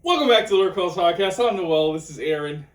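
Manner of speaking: high, loud mock hype intro; excited shout. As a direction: blast the intro like a hype host. Co-host mocks her intro.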